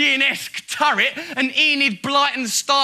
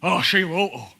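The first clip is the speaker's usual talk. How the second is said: mock dread; 6 semitones lower than usual. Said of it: "he sort of shudders with dread".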